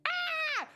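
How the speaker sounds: a sudden piercing shriek, very high. Pretend. Acting as a Kuko on screen, shrieking sharply. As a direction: let out a fangirl's squeal. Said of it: "I'm shrieking".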